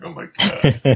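high, loud hammed-up shock; mock horror. Co-host voicing the passenger seeing an ear on the seat, mock horror. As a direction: blurt it out, aghast, for laughs.

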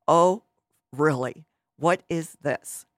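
acted scoffing disbelief; having fits. Goliath seeing small David come out, scoffing mock fit. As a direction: scoff at a puny challenger, loud on the first word. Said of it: "he was having fits".

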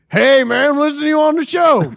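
high, loud holler down the line; retold yelling into phone. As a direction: shout to be heard over the noise.

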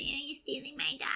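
high, put-on whiny woman's voice; a sneering take on the drugged-out woman; mocking the woman. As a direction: mimic her silly accusation. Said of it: "then she was like".

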